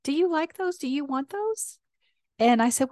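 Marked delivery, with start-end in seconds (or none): 0.0-1.8 much higher, sweet grandmother voice; an old grandmother offering her grandchild donuts, high and sweet; imitating grandmother. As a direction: lift way up into a doting grandma voice. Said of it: "and she goes".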